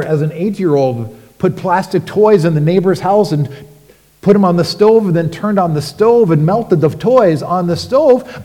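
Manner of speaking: ordinary delivery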